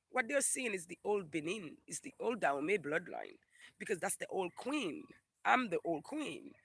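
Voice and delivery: usual speaking voice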